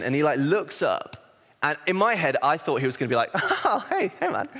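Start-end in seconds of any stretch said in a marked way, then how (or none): 3.3-4.5 high-pitched, chummy hello; imagined over-friendly greeting in a high voice; delighted greeting. Retold or imitated. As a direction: greet like a long-lost mate, pitch leaping up.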